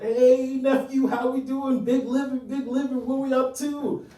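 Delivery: high, hearty uncle greeting; boisterous uncle. Acted. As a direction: holler it high, like an uncle greeting his nephew. Same uncle's greeting, acted.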